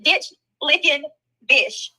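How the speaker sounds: slow, high "ditch licking" then "bitch"; savoured quote. Retold or imitated. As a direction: relish each word of the insult. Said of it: "She said, she said".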